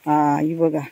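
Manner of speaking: usual speaking voice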